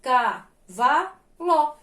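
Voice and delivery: "Cavalo" in three slow, separate syllables. Shown as a demonstration. Teacher helping pupils count syllables, very slowly. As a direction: pause after each syllable of "Cavalo". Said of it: "Vou falar bem devagarzinho".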